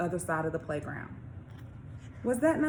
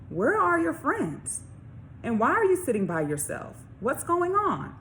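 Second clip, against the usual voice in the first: high motherly questions, first slowed; a mom gently probing her boy; mother's voice; 7 semitones higher than usual. Acted. Mother asks about friends.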